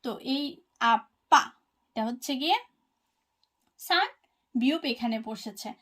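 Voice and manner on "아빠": আপ্পা said loud with a held double p; pronunciation demo. Shown as a demonstration. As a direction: model আপ্পা clearly with a hard pp. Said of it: "এই আপ্পাটা হচ্ছে আমাদের দেশে বাবা".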